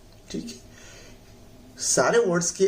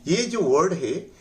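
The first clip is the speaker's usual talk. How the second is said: normal talking voice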